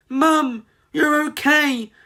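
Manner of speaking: high, slow relief; relieved cry. A narrator's reading. Molly finding her mum safe, high and relieved. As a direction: sigh out the relief. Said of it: "cried Molly in relief".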